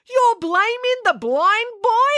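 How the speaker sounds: high, slow outraged disbelief; Mister Lang cries out at April's accusation; crying out. A narrator's reading. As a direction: cry it out, scandalised. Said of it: "cried Mister Lang".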